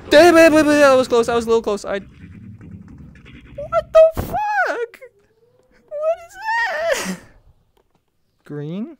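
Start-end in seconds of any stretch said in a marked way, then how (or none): 0.1-1.3 startled outburst. Real, not acted. Startled by near miss in game. 2.0-7.2 very high, drawn-out squeal of fright; alarmed disbelief. Real, not acted. Creepy sight in horror game.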